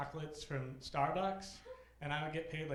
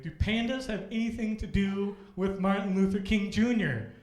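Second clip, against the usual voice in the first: loud, higher indignant question; mock outrage; 6 semitones higher, 11 dB louder than usual. Imitating the principal confronting him, loud and indignant. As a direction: demand it like an annoyed boss.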